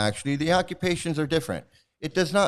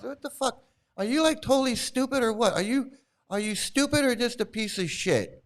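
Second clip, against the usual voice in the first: angry rant; 9 semitones higher than usual. Real, not acted. Ranting insults at a commenter.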